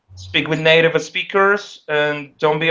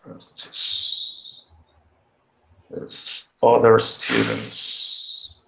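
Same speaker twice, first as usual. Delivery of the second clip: muttering to self; 4 semitones lower, 4 dB quieter, much slower than usual. Real, not acted. Repeating tip while taking notes.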